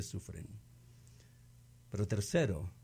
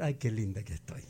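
mock vanity; 6 semitones lower than usual. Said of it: "va a decir".

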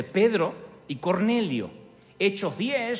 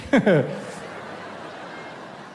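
breathy laugh. Real, not acted. Laughs after own impression.

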